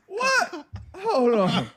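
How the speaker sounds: high-pitched mock disbelief; incredulous squeak, imitating a shocked reaction; retold shock. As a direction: act out stunned teammates.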